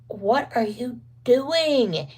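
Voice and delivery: high, very slow, drawn-out question; teasing her husband in the wheelchair; mock exasperation. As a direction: stretch it out in disbelief.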